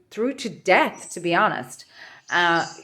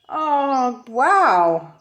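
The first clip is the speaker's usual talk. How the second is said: surprised; 5 semitones higher than usual. Real, not acted. Tarot card pulled, strong reaction.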